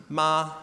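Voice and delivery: ma held high and level; ma, first tone, high and flat. Shown as a demonstration. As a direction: sing ma on one high steady pitch. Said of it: "The first tone is high and flat".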